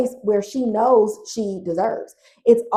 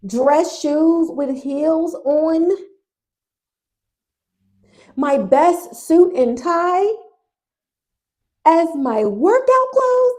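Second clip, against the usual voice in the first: incredulous rant; 6 semitones higher, 4 dB louder, slower than usual. Real, not acted. Ranting at the old clothes.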